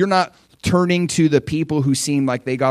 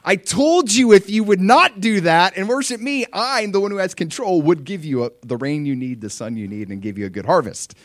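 God scolding; 5 semitones higher, 4 dB louder than usual. Retold or imitated. Preacher voicing God's rebuke to unfaithful kings, raised then fading. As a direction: voice a frustrated God reminding his people. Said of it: "the one true God, is going".

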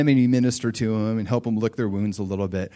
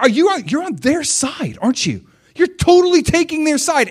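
much higher, louder mock accusation; accusing; 6 dB louder than usual. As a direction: hurl the accusation like a betrayed friend. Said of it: "They're gonna be like".

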